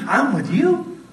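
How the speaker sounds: rising questioning tone. Shown as a demonstration. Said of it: "same words, different meaning".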